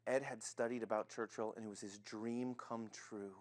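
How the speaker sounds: low, soft, slowing storytelling; hushed narration. A narrator's reading. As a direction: tell it quietly, like a cherished secret. Narrator softens for Ed's dream.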